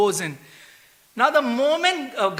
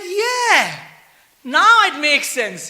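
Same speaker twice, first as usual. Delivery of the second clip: delighted realization; 7 semitones higher, 6 dB louder, much slower than usual. Retold or imitated. Yamaraj, delighted at understanding.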